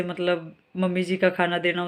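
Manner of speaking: ordinary talk, nothing marked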